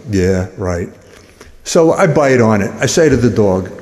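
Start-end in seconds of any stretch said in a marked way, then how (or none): 0.1-0.9 low, deadpan dismissal; unimpressed doubter; dry disbelief. Acted. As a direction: dismiss it with a skeptical drop in pitch. Skeptical reply to sale offer.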